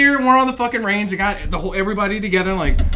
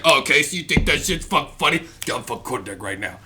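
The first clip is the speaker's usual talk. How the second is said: low, menacing drill instructor, falling away softer; Staff Sergeant DeBell; 10 semitones lower, 8 dB quieter, faster than usual. Retold or imitated. Drill instructor catching a recruit laughing, in a low menacing imitation. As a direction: imitate a sergeant's deep rebuke, quickening at the end. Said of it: "I was laughing so fucking hard".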